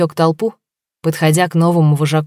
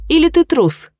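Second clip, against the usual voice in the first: taunting; 11 semitones higher than usual. Acted. Taunting the Buffalo into fighting.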